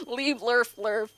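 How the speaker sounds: "Lee blurf lurb" said slow as garbled gibberish; imitated Simlish. Comedian quoting The Sims game, slow mock Simlish "Lee blurf lurb". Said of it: "She sang in Simlish".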